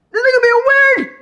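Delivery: hammed-up high screech of disbelief; mock shriek. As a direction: shriek it like you're outraged. Repeats line, then shrieks it.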